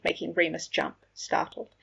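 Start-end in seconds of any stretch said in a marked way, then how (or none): none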